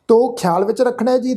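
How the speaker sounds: ordinary delivery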